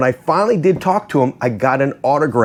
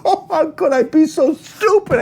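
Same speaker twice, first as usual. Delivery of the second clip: high, laughing disbelief; laughing self-reproach. Real, not acted. Owning up to giving away the signed hat, laughing in disbelief. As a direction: groan at yourself through real laughter. Laughing at his own blunder.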